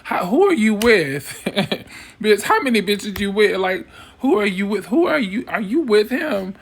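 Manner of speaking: high, sharp who are you with; incredulous demand. Retold or imitated. Woman in a club demanding who the other girl is with, incredulous. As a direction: push the question, half laughing, half daring. Counting heads, confronting woman.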